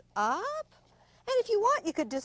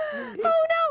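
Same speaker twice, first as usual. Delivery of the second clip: high, loud, frantic cry; panicked shouting; 4 dB louder, faster than usual. Acted. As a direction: scream for escape, fast. Zebra flees from leopard.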